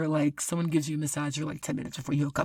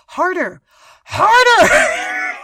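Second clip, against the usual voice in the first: loud shout that climbs much higher on the second word; screamed out; 15 dB louder, much slower than usual. Retold or imitated. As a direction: yell it out, carried away. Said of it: "I just screamed out".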